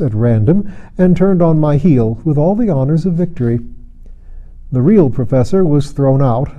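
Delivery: ordinary delivery, nothing marked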